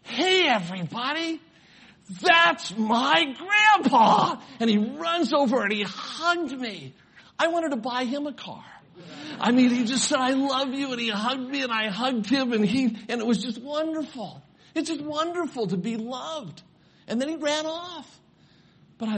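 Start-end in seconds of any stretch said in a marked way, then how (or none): 0.0-4.4 put-on high, loud, proud little boy; imitating an excited grandson showing off grandpa; big-smile announcement. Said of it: "with a great big smile". 4.6-18.2 high, emotional storytelling at full voice; swept-up, high-pitched testimony; impassioned preaching. Real, not acted.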